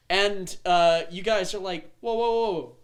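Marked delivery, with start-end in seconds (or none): 2.0-2.8 higher, louder alarmed interjection; acting out a party taken aback; startled reaction. Party reacts to shouting stranger.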